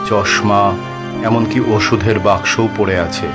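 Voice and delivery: usual speaking voice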